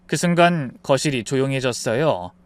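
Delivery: plain reading voice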